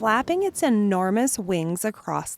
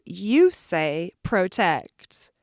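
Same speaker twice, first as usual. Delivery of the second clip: plain reading voice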